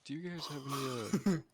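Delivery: drive-thru customer. Retold or imitated. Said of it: "that one guy that goes to the McDonald's drive-thru".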